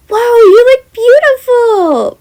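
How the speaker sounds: high, loud, slow gushing; admiring gush. Acted. Girl gushing over the teacher's outfit, high and slow. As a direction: draw it out in awe. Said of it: "admiring Jem's outfit".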